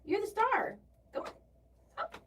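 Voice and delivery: high pet-talk voice; coaxing a pet. Real, not acted.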